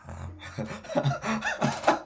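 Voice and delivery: high breathy disbelieving huh; a stunned, squeaky huh as the money lands; baffled huh. Retold or imitated. Trader reacting to his account balance, baffled. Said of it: "I'm like".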